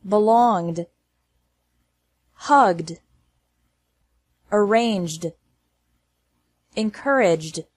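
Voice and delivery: "Robbed", "Hugged", "Judged" closing on a voiced d, no extra syllable. Shown as a demonstration. Teacher practicing the most difficult endings, ED as D. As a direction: carefully model "Scrubbed", "Managed" singly, final d joined on. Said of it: "E D is pronounced like D".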